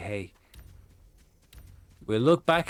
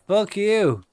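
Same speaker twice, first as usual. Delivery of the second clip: sharp, loud, higher curse; angry yell; 10 semitones higher, 8 dB louder than usual. Real, not acted. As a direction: yell it at the enemy. Yelling at enemy mid-game.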